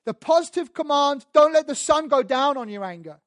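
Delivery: loud, high pulpit proclamation; preaching at full force. Acted. Preacher to church reading Paul's commands, loud and high.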